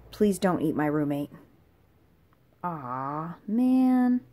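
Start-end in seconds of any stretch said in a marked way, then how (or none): 2.6-4.2 drawn-out whine sinking to low grumble; grudging disappointment. Acted. Guest told not to eat the roommate, grudging disappointed groan.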